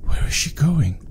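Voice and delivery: hushed unvoiced whisper. Real, not acted. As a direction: whisper so she won't hear. Whispering while watching the girl.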